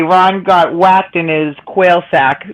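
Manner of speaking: "Ron got whacked in his quail sack" spelled out slowly; slow demo. Shown as a demonstration. Setting the night's challenge, slow and clear. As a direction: lay out the tongue twister slowly for all. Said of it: "That's the challenge for the night".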